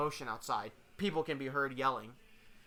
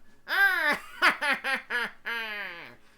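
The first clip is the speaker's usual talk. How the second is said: loud, high acted laugh; drawn-out stagey laughter; 6 semitones higher, 6 dB louder than usual. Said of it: "[name] starts laughing a little".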